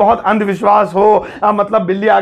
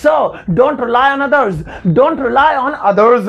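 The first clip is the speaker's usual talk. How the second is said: slow, rely stressed; slow demo sentence; 3 semitones higher, much slower than usual. Shown as a demonstration. Teacher modelling the English sentence to students, slowly. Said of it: "कुछ अपनी मेहनत से भी कमाओ".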